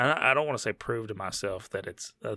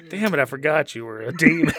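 ordinary talk, nothing marked

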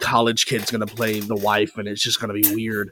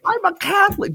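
mock protest. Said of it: "I was like".

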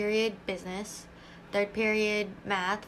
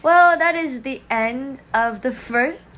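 slow, louder announcer close; announcer sign-off; 3 semitones higher, 6 dB louder, much slower than usual. Acted. Host announces end of first podcast, slow and grand. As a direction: wrap up the show grandly.

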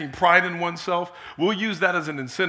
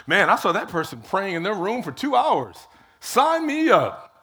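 mock impressed onlooker; 4 semitones higher, 4 dB louder than usual. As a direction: gush over it like a fan, laughing.

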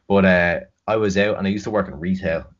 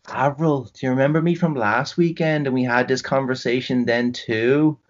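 higher chirpy stranger's voice; random woman's voice; 6 semitones higher than usual. Retold or imitated. Stranger reminding drunk Avril of last weekend, chirpy higher voice. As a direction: play an over-friendly stranger. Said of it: "some random woman comes over to her and she's just".

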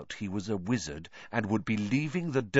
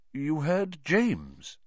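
slow, higher strained question; strange voice; 6 semitones higher, much slower than usual. A narrator's reading. Lupin hearing his friend's name, slow strange voice. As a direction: draw it out, oddly taken aback. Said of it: "in a strange voice".

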